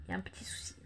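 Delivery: hushed confiding whisper. Real, not acted. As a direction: whisper the little problem. Whispered confession of a problem.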